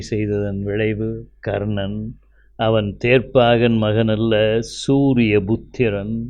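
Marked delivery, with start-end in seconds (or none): none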